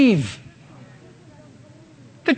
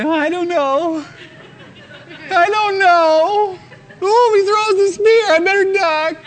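higher, laughing hammed-up nervous dither; mock timid dithering; 6 semitones higher than usual. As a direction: play a cowardly soldier ducking spears, half-laughing. Timid believer mocked against bold David.

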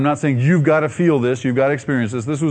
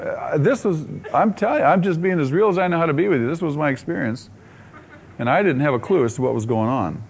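ordinary talk

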